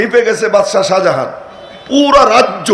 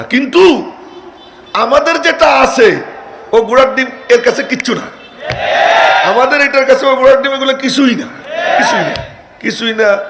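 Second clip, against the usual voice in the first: fiery preaching; 7 semitones higher than usual. Real, not acted. Preacher's rising fiery climax.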